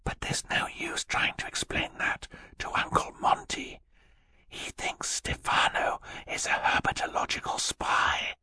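very soft, breath-only whisper. A narrator's reading. Sister warning her brother about Stefano, whispered. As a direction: keep it secret, all in a whisper. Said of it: "Violet whispered".

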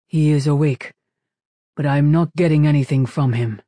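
loud, emphatic character voice; machine boss. A narrator's reading. Machine boss reporting to captain over intercom, loud and emphatic. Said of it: "The machine boss's voice sounded over the intercom".

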